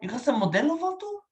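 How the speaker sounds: high-pitched Chicano caricature; Chicano accent. Acted. Impressionist's last accent for a stranger, high Chicano voice.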